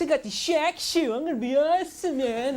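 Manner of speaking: proud of his new shoes; mock cocky kid. Guy buying Air Jordans.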